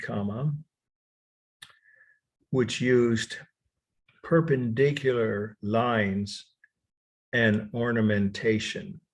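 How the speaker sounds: measured dictation, long gaps between phrases. Real, not acted. Lecturer dictating the last definition to students, dictation. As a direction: dictate in chunks, pausing for writers.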